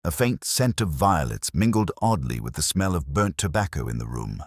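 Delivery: ordinary delivery, nothing marked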